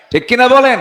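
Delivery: high, shouted question to the crowd; loud rallying call. Real, not acted. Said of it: "আস্তে কন কেন".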